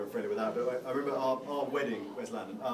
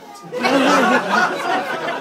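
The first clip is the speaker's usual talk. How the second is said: bright burst of laughter; real laugh breaking out; loud laugh; 12 semitones higher, 14 dB louder than usual. Real, not acted.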